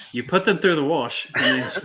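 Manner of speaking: attempted Australian accent. Quoting a movie character to friends, shaky attempt at Australian accent. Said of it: "sounds like Shrek when he does an Australian accent".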